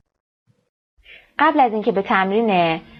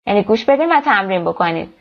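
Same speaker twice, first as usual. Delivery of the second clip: normal talking voice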